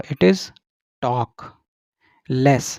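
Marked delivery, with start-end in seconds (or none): 1.0-1.5 "talk" said as tawk, with no L; silent L. Shown as a demonstration. Teacher shows students the word, "talk" with silent L. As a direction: leave the L out of "talk". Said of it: "यहाँ पर हम एल silent होगा".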